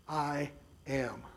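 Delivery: solemn, hushed "I am"; emphatic I am. Acted. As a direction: set "I am" apart, low and heavy. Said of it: "which is a very emphatic statement".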